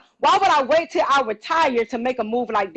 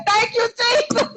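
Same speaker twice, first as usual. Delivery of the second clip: thankful exclaim; 11 semitones higher, slower than usual. Retold or imitated. Relieved daughter joined call.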